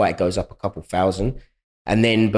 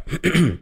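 coughing; 8 semitones higher than usual. Real, not acted. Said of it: "Sorry, I'm coughing so much".

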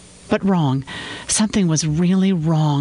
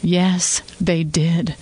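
ordinary delivery

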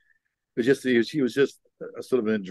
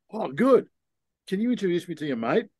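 higher, eager put-on voice; mock eager reply; 6 semitones higher than usual. Wingman answering the girl to get her friend, mock eager. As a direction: brush her off to ask about the mate. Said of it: "And I go".

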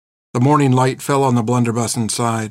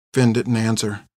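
ordinary delivery

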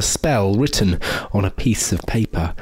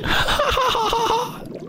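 high evil witch cackle. Acted. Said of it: "the witch said as she cackled".

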